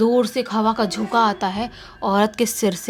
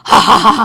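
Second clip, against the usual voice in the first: loud evil cackling laugh; creepy witch cackle; 12 dB louder than usual. Acted. Witch laughing at the terrified boy, loud evil cackle. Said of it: "बहुत ही गंदी creepy सी आवाज में हंसती है".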